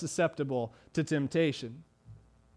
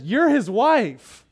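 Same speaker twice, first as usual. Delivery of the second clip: louder and higher, urgent; impassioned plea; 6 semitones higher, 6 dB louder than usual. Pretend. Preacher voicing Joseph's protest, louder and higher. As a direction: press it home with rising force. Joseph's reason, rising intensity.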